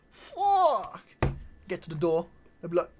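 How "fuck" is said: high, loud drawn-out swear; mock shock. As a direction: let it burst out high and loud. Acted scene of shocked swearing.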